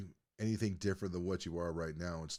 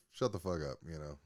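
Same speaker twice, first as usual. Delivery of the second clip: ordinary talk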